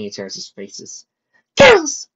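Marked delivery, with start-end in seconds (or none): 1.6-2.1 very high, loud yell. Acted. Pony yelling at her arguing friends, high loud yell. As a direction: yell to be heard over them all. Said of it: "yelled, loud enough to be heard".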